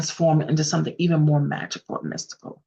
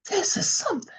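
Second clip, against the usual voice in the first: amazed; 14 semitones higher, 4 dB quieter, faster than usual. A narrator's reading. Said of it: "is amazed at the world".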